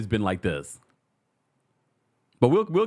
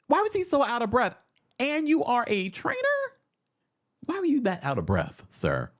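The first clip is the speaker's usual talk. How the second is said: mocking disbelief; 11 semitones higher than usual. Real, not acted.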